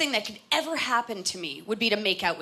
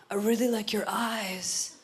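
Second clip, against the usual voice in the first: creepy sweet talk; slower than usual. Retold or imitated. Incestuous lover's sweet talk.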